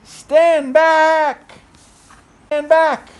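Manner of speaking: loud high warning shout. Acted. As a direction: call out to stand back. Piggy shouts in story.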